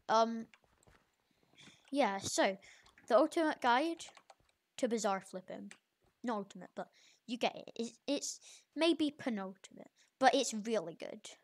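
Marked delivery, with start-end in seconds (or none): none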